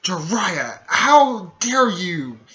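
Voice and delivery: loud, slow mock outrage; pissed off. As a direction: drag out the accusation, imitating an angry woman. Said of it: "she gets pissed off at".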